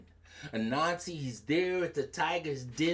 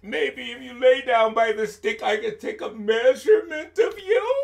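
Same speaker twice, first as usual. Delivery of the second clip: higher, louder coaxing; coaxing Anansi; 6 semitones higher, 5 dB louder than usual. Acted. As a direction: sweet-talk the snake. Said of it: "Anansi said".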